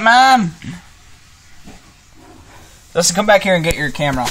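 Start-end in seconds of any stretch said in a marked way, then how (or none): 0.0-0.5 shouted call across house. Real, not acted. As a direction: call loudly to someone away. Calling out to mother.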